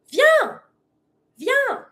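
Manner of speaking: very high, bright-pitched call; super energetic command. Shown as a demonstration. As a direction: call the animal over excitedly. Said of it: "We always try to be super energetic".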